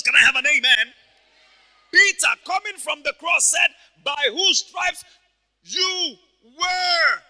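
high, shouted, triumphant declaration; full-force preaching. Acted. Preacher declaring healing from the cross, full-force preaching. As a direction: build to a booming proclamation of victory.

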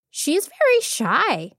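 high little monkey voice, unruffled; calm reply. A narrator's reading. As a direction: answer the queen calmly in a small voice. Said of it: "he replied calmly".

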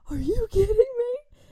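high, loud mock outrage; squealed mock scolding; retold mom's yell. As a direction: imitate an appalled mom, pitch shooting up. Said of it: "when she yelled that out".

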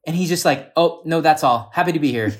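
high, loud excited retelling; quoting Joe. Retold or imitated. Retelling what Joe said about Isaac, high and loud. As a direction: mimic a worked-up friend's rant. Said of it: "He was like".

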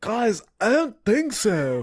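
slow, dopey surfer-dude drawl; Keanu Reeves impression. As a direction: drag it out like a spaced-out Keanu. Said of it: "you still did a Keanu Reeves impression".

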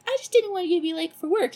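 high, quick, sweet excuse; mock-innocent mother voice; innocent mom. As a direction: rattle it off high, all innocence. Mother excusing herself to startled son.

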